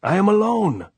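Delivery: very loud high desperate cry; desperate shout. A narrator's reading. Andres pleading with the sentries, desperate shout. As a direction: yell it up the slope. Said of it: "Andres shouted".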